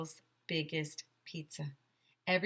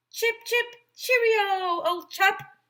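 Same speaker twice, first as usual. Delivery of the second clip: much higher hammed-up English accent, louder; English lady accent; 9 dB louder, slower than usual. English record lady greets Mister Tony in an English accent. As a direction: play a chirpy English record keeper in an English accent. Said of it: "she said".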